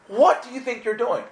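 high, indignant challenge to the angels; indignant demand. Retold or imitated. To God, furious that his angels burned her brain. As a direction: demand an answer, outraged.

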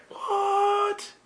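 high squeaky disbelief; a stunned what, way up; incredulous. Acted. Skeptic hearing God is your friend, high incredulous.

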